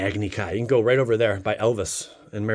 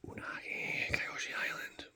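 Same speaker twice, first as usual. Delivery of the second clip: whispered card names; 19 dB quieter than usual. Acted. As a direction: hush it like a secret reveal. Reads card names while unboxing.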